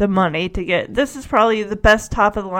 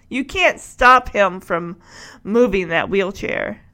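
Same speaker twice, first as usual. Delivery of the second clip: normal talking voice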